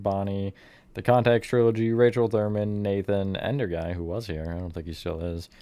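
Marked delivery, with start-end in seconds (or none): none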